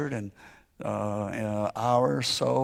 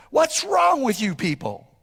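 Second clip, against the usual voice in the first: exasperated shout; 11 semitones higher than usual. Retold or imitated. Retold outburst at crying family.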